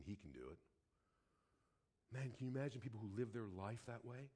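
low, soft, reflective sermon voice; hushed, grave preaching. Acted. Preacher recalling the scare with his son, hushed and sober. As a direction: bring it right down, nearly to yourself.

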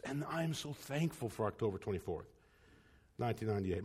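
soft heartfelt thanks. Real, not acted. Preacher thanking God for the day his fiancée said yes, softly moved. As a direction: let the date land quietly, moved.

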